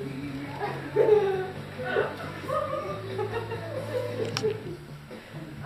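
giddy high giggling laugh. Real, not acted. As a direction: burst into tipsy laughter. Drunk laughter after outburst.

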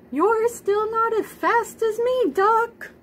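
high, put-on boastful horse voice; smug horse. A narrator's reading. Horse boasting to Duck in a high smug voice. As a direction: brag like a proud horse. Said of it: "what he thought was".

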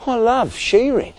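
high, mildly plaintive; sad. A narrator's reading. Sean missing his shearing, sad. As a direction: lament with a touch of sadness. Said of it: "Sean asked sadly".

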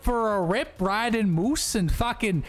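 high-pitched, hammed-up Canadian caricature; mock Canadian accent. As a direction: tease them in an exaggerated Canadian accent. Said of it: "people make fun of Canadians all the time".

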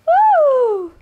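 loud, very high "ooh"; high cooing squeal. Real, not acted. Mother answering her baby, high loud coo.

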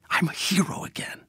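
breathy near-whisper, softly awed; hushed awe. Acted. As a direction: say it almost in a whisper, overcome. Emotional confession in letter to daughter.

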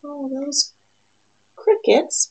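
ordinary delivery, nothing marked

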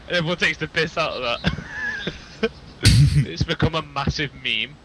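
ordinary talk, nothing marked

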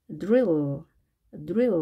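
드릴 as English drill, fused DR, one dark L; one syllable for 드릴 with curled R. Shown as a demonstration. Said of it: "L이 두 개지만 하나만 발음하죠".

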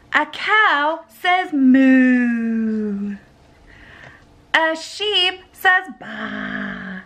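slow, drawn-out read-aloud; slow sing-song storybook voice. Acted. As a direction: read it slowly for a little one. Mom reading animal book to toddler.

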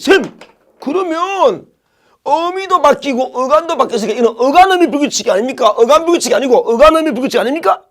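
teacher imitating a student's objection; imitated student. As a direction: pipe up like a keen pupil challenging the teacher. Teacher voices a student's question.

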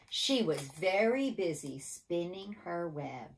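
measured refrain, stretched out word by word; say-along refrain. Shown as a demonstration. Teacher leading children in the refrain, deliberate and drawn out. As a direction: draw out each word so children can join in. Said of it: "Are you ready?".

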